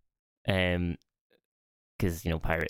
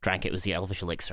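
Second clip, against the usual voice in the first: usual speaking voice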